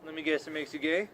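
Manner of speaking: usual speaking voice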